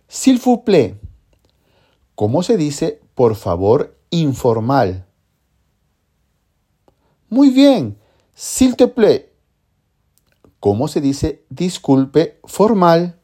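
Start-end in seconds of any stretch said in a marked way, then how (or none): 0.1-1.0 "s'il vous plaît" much higher, upbeat; bright model. Acted. Teacher answering own quiz, upbeat. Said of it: "teacher praising a learner". 7.3-9.3 delight in the answer; excited praise. Acted. Teacher cheering a student, very upbeat. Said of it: "teacher praising a learner".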